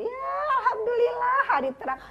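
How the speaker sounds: high, loud mock-delighted exclamation; sarcastic mockery. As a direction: gush in fake praise to needle the child.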